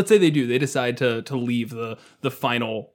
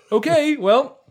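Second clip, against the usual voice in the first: much higher, louder nervous bye; party leaving the Barghest, high-pitched awkward goodbye; 13 semitones higher, 5 dB louder, slower than usual. Retold or imitated. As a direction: back away fast and awkwardly.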